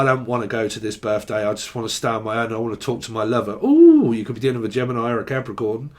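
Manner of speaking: normal talking voice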